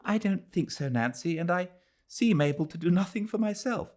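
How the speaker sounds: faint high weary reply; lightly voiced tired lady. Adela answering her worried friend, a hint of weariness. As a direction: answer as if drained.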